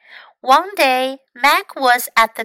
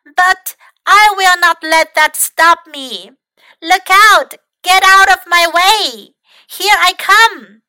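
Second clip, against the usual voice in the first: loud, high, bold yell; 7 semitones higher, 8 dB louder than usual. Acted. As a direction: shout it out, daring and fearless. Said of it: "yelled Mac".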